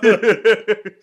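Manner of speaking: high loud burst of laughter. Real, not acted. Officer laughing at his own joke, loud burst. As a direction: crack up.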